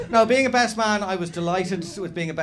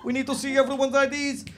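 high, louder comic Eastern European policeman; Eastern European accent; 10 semitones higher, 4 dB louder than usual. Acted. Fake cop addressing the stag party, Eastern European accent. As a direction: bellow orders in a broad mock Eastern European accent. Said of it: "this is my Eastern European accent".

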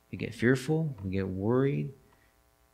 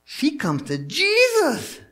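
high, slow, louder reveal; dramatic reveal; 7 dB louder, slower than usual. Acted. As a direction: make it a big slow moment.

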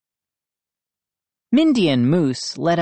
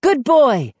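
loud, high praise to a dog; crying out; 9 semitones higher, 5 dB louder than usual. A narrator's reading. Brother praising the dog at the window, crying out. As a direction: cry out encouragement. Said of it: "I cried".

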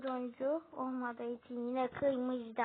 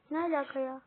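usual speaking voice

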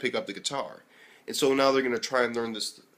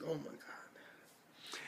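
slow, breathless whisper; whispered oh my god; 4 semitones higher, 21 dB quieter, much slower than usual. Retold or imitated. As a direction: hush it out in stunned disbelief. Reacting to classmate, awkward moment.

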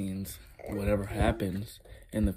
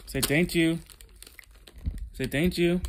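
high, louder sing-song prompt; 8 semitones higher, 3 dB louder than usual. Real, not acted. Parent teaching toddler manners, coaxing. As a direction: lift the pitch to prompt a little one. Coaching a child to say thanks.

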